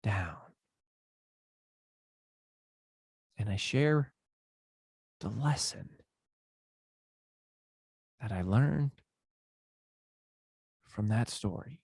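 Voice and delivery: suddenly soft and low; dropped way down. Shown as a demonstration. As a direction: fall quiet to share the lesson. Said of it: "I drop it way down".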